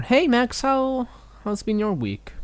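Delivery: very high, bright cutesy anime voice; anime girl voice. Pretend. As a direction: squeak it like a cartoon schoolgirl. Playing cutesy anime character.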